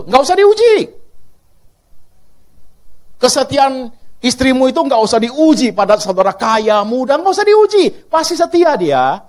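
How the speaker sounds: loud, high fervent preaching; full-force preaching. Acted. Preacher rouses the congregation, loud and fervent. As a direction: belt it out to the whole church.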